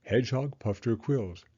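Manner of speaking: ordinary delivery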